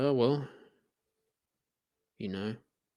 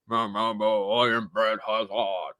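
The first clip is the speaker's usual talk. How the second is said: put-on low, droning cranky old man; Brad Hazzard; 9 semitones lower than usual. Mocking the health minister, low cranky old man voice. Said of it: "cranky old man".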